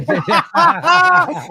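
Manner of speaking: sudden high loud laugh; big high laugh breaking out; high burst of laughter. Real, not acted.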